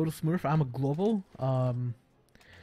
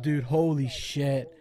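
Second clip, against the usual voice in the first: normal talking voice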